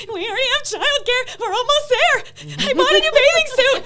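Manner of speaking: high, loud, frantic squeal with a laugh; hammed-up panicked wife, shrieking in alarm; mock panic. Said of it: "just panicking".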